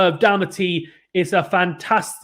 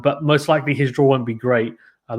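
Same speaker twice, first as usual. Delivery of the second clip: ordinary talk, nothing marked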